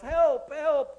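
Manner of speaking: high, loud cry of help; desperate high yell for help. Acted. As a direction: shout it out in panic. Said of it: "he immediately yells".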